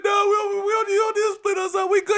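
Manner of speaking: high, hurried protest; pleading kids. Retold or imitated. Kids begging grandpa not to split them, high protest.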